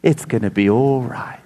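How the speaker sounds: soft, low motherly reassurance; imitating a mum's tender comfort; soothing mother. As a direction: hush a hurt child gently. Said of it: "You climb in the green chair".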